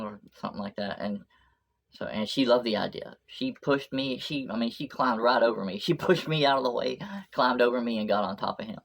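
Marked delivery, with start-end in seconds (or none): none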